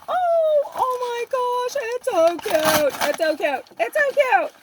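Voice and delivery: high, loud gushing over something cute; excited squeal. Real, not acted. Vlogger opening a friend's gift, squealing in excitement.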